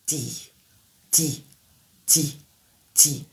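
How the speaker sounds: di, block the air and release; voiced D. Shown as a demonstration. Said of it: "On bloque l'air, puis on le relâche".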